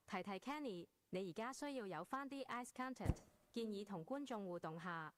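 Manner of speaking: soft private reminder; quiet aside. Acted. AI privately prompts Kenny. (細聲私下提醒)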